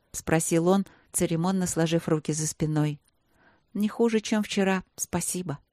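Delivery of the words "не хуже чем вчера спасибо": usual speaking voice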